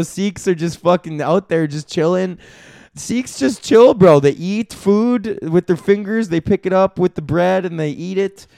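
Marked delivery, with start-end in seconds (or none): 2.9-4.2 higher and louder, hyped; excited. Real, not acted. Comedian hyping up chill Sikhs, louder excited. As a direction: pump it up with delight.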